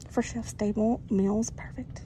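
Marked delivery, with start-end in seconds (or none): 1.5-2.0 breathy store whisper. Real, not acted. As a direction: whisper it to yourself. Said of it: "I feel like I have to whisper".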